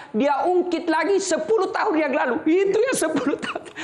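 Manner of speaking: high laughing mockery of a nag; mock high nagging. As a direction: squeak it up like a nagging wife. Preacher mocks nagging wife's grudges. (ejekan dengan suara tinggi sambil tertawa)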